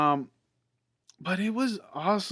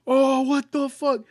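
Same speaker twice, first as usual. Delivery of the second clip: high, drawn-out mock outcry; hyped amazement; 9 semitones higher than usual. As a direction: re-enact being blown away by a movie scene. Retelling own reaction to hyped scene.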